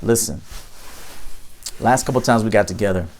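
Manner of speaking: calm firm talk to a late date. Pretend. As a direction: set the boundary without heat. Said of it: "Notice I wasn't emotional".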